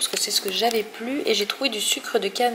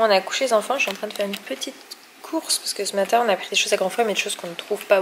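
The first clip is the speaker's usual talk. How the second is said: usual speaking voice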